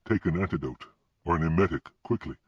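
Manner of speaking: plain reading voice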